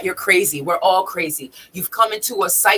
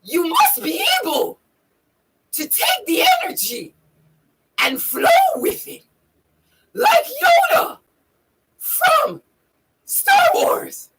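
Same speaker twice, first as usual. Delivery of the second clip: very high, squeezed creature voice; Yoda. Acted. Explaining the Yoda voice to viewers, high Yoda voice. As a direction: deliver it in pieces, with long pauses, like a wise alien. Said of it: "I'm using my Yoda voice".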